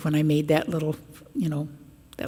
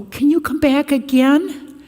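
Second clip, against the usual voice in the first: put-on kids pleading; 10 semitones higher, 6 dB louder, slower than usual.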